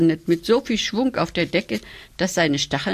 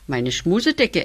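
ordinary delivery, nothing marked

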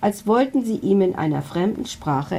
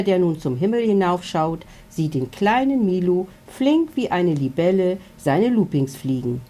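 plain reading voice

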